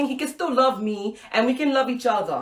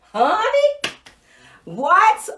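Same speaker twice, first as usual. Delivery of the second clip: much higher, louder, incredulous cry; stunned disbelief; 10 semitones higher, 7 dB louder, much slower than usual. Real, not acted. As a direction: gasp it out in shock. Shocked he's teaching there.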